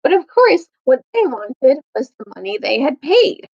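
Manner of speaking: ordinary delivery, nothing marked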